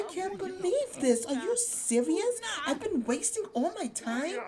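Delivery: high, loud acted indignation; upset outburst. Luna reacting to losing the bakery, acted upset. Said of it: "She's so upset".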